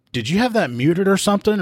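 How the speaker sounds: high-pitched retold astonishment; disbelieving. Trainer questioning the rookie after the call, imitated disbelief.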